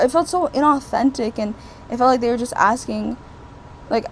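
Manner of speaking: ordinary talk, nothing marked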